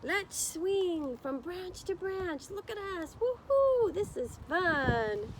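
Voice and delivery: high, whooping monster party voices; playful wild things. Pretend. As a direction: whoop and holler like monsters having fun. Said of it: "they're all roaring".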